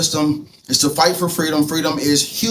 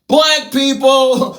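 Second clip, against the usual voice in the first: comic reveal; 5 semitones higher, 3 dB louder, much slower than usual. Acted. Comic reveal of first group.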